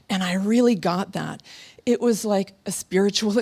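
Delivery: ordinary delivery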